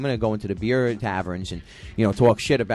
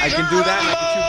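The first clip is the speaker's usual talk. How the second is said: falsetto show-off voice; 5 dB louder, faster than usual. Acted. Showing off talented voice.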